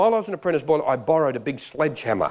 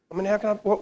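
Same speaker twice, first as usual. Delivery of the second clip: ordinary talk, nothing marked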